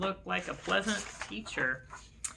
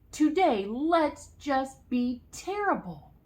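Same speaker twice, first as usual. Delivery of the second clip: put-on mischievous kids; 7 semitones higher, 3 dB louder, slower than usual. A narrator's reading.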